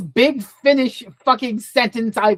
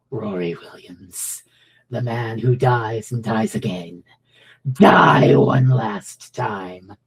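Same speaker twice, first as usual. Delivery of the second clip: low and slow, building to a shout; slow low threat, then roar the end; menacing villain; 8 semitones lower, slower than usual. Retold or imitated. Quoting the villain's menacing line.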